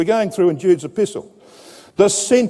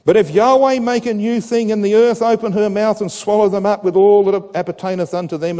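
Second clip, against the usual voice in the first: raised, measured reading voice; scripture reading; 4 semitones higher than usual. Acted. Preacher reading the verse aloud to the congregation, proclaiming.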